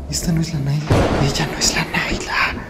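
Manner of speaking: unvoiced whisper of dread. Real, not acted. Vlogger whispering to viewers in dread. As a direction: whisper it in horror. Spooked realization.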